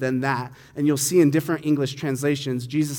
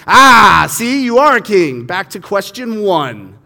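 much louder, higher "Ah, see"; triumphant gotcha; 5 semitones higher, 12 dB louder, slower than usual. Retold or imitated. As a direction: gloat that he caught him. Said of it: "Pilate just was like".

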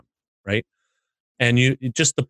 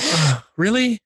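high, loud incredulous groan; ugh, really, fed up; exasperated disbelief; 9 semitones higher, 4 dB louder, slower than usual. Retold or imitated. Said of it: "I'm like".

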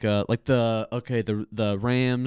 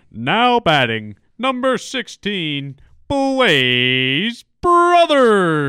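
slow, much higher, louder ballpark call; Phil Nevin PA voice; 8 semitones higher, 7 dB louder, much slower than usual. Retold or imitated. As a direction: stretch the name out over the stadium. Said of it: "I loved hearing Bill Nevin".

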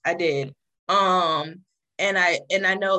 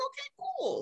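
high squeaky okay; 9 dB quieter than usual. Retold or imitated. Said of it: "And I'm like".

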